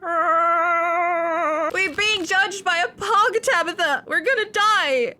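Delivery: high, loud comic despair; exasperated outburst. Acted. Player despairing of the ghost game, hammed-up wailing.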